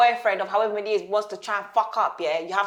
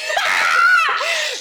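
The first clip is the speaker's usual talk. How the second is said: high screaming burst of laughter; shrieking laugh; 5 dB louder than usual. Real, not acted. Host cracking up at her co-host's mockery, shrieking laugh. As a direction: crack up helplessly.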